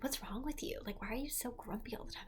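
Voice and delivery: whispered, unvoiced; mom's nagging in a whisper; whispered mom quote. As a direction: hush it like a retold scolding. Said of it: "her driving me home ... being like".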